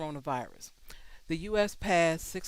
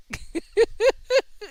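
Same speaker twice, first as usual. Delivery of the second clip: high, loud giggle; scoffing laugh; 8 dB louder than usual. Real, not acted. Host reacting to "ahead of the curve", laughing. Scoffing at the news just read.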